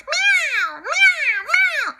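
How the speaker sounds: high cat meows; high-pitched meows; cat meowing. Acted. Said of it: "The cat meowed".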